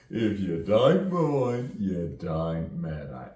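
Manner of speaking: loud, drawn-out London accent. Shown as a demonstration. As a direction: belt it out in broad London vowels. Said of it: "you know, from London".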